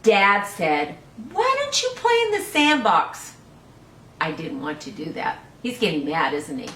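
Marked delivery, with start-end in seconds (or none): 0.0-1.0 put-on lively read-aloud for small children; storybook reading. Teacher reading a picture book to children, lively. 1.3-3.3 much higher, bright suggesting dad; cheery dad voice. Acted. Dad suggesting the sandbox to the sulking boy, higher cheery. 4.2-5.4 put-on lively read-aloud for small children; storybook reading. Teacher reading a picture book to children, lively.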